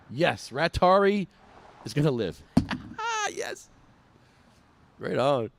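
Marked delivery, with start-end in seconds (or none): 2.6-3.3 a sudden high-pitched squeal of laughter. Real, not acted. Delighted at the blow-up rat, high squealing laugh.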